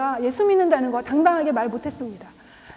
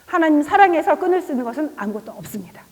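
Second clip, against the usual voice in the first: ordinary delivery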